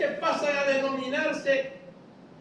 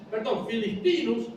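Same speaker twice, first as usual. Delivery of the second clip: ordinary delivery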